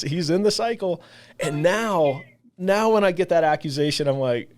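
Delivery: ordinary talk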